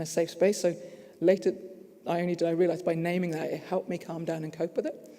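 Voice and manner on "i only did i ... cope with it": ordinary delivery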